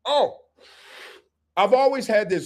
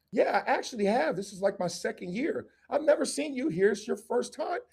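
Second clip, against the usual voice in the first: higher, softer chatty voice; flirty yoga woman; 5 semitones higher, 5 dB quieter than usual. Acted. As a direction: play the woman making friendly small talk.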